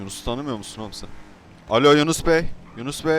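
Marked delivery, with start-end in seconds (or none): 1.7-3.1 loud hail down the phone; calling out. Acted. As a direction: shout into the phone to get him. (telefona seslenerek bağırma)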